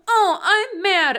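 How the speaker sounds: put-on high, slow, whiny pouting child voice; childish mock anger. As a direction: imitate a bratty kid stamping her foot. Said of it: "stamp one foot down and be like".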